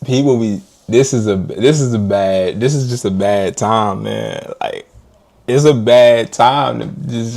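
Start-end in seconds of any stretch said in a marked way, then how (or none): none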